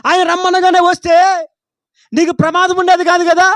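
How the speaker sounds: loud, driving rebuke of laziness; fiery preaching. Acted. Preacher rebuking the congregation for laziness, loud and driving. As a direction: hammer each line home like a prophet.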